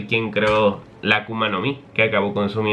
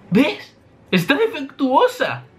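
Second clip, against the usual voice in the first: much higher, slow gleeful declaration; triumphant Vegapunk; slower than usual. Acted. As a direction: declare it proudly as if obvious.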